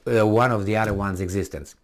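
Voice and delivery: ordinary talk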